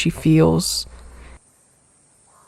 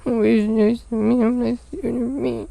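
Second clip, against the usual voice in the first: tearful angry mumble; 5 semitones higher, 3 dB quieter than usual. Acted.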